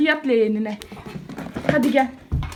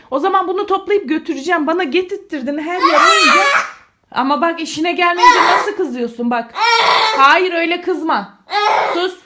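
raised, higher-pitched telling-off; scolding; 6 semitones higher, 7 dB louder than usual. Real, not acted. Mother tells child off over scattered toys, scolding. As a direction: scold with lifted pitch and volume.

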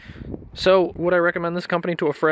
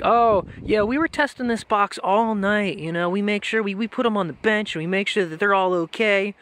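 higher, slightly sing-song mock of the shop's excuse; mocking shop guy; 5 semitones higher than usual. Mocking the shop's excuse to an angry customer, mock-breezy voice.

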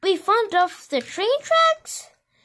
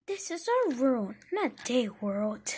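secret whispered aside; 7 semitones lower, 10 dB quieter than usual. Pretend. Toy plotting against the buses, whispered. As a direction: hiss it low like a secret plan.

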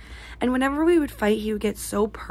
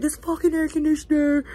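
mock rant; 6 semitones higher than usual. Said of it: "if you're like".

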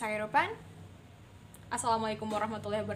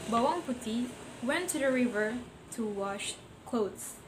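ordinary delivery, nothing marked

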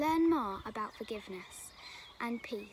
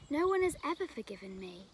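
ordinary delivery

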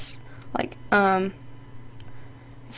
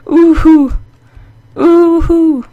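very loud high hoot; triumphant hoot; 6 semitones higher, 13 dB louder, much slower than usual. Real, not acted. Gamer whooping after grabbing the pig, triumphant. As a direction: whoop in victory.

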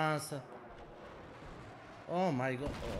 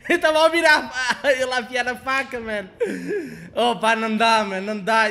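laughing complaint; 6 semitones higher, 9 dB louder than usual. Real, not acted. Laughing, frustrated at game.

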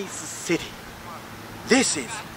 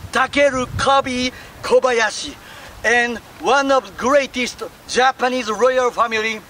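ordinary talk, nothing marked

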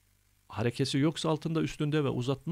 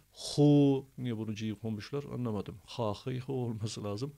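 ordinary delivery

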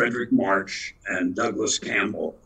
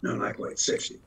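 normal talking voice

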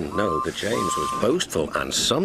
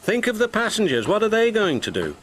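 urgent; 10 semitones higher, faster than usual. A narrator's reading. Skarloey urging.

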